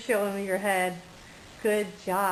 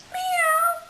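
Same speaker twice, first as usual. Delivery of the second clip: high, loud squeaky meow; a cat's meow, way up high; kitty meow; 5 dB louder than usual. Pretend. Mother voicing the kitty for the baby, high meow. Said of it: "say kitty cat".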